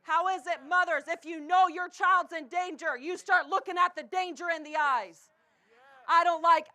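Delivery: usual speaking voice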